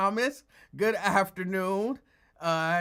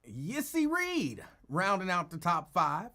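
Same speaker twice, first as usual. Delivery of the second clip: usual speaking voice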